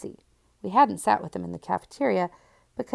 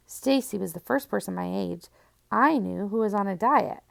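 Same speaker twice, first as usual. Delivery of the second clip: ordinary delivery, nothing marked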